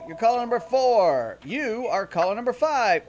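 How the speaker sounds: excited caller countdown; radio contest promo. Acted. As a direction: DJ calling out caller numbers.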